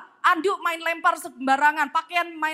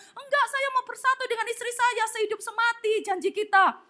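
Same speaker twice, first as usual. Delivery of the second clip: high, melodramatic widower voice; comic graveside lament; mock grief-stricken protest; 7 semitones higher, 3 dB quieter than usual. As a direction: insist tearfully, hamming it up. Widower insists on joining wife.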